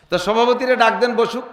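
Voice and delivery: ordinary talk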